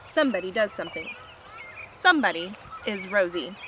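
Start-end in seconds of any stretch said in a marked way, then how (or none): none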